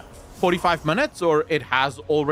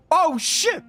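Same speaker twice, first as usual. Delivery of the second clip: high sudden yelp; startled yelp; 13 semitones higher than usual. Real, not acted. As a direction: yelp in surprise. Sudden surprise in game.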